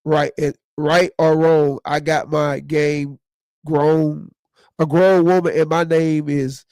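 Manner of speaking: low, slow reading of Reggie's words; quoting Reggie. Retold or imitated. Quoting a guest's comment to the audience, slow and low. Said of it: "Reggie said".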